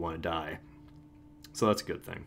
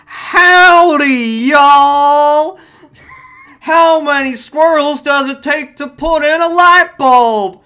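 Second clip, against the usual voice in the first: much higher and louder, slow Texan hollering; 16 dB louder, much slower than usual. Pretend. Telling squirrel jokes to friends, loud mock Texan comic. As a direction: holler it like a goofy country comic. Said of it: "I'm gonna do squirrel jokes".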